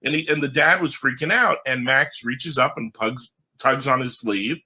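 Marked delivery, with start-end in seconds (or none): none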